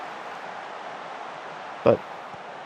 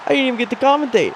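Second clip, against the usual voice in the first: surprised exclamation; 10 semitones higher, 3 dB louder than usual. Real, not acted. Missed the goal, exclaims.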